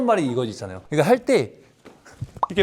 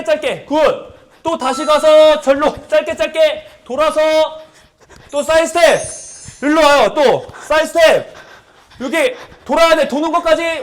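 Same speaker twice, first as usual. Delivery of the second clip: loud, higher coaching cues; barking gym coach; 9 semitones higher, 4 dB louder than usual. Real, not acted. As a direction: call the steps out over the music. Coach calling step cues.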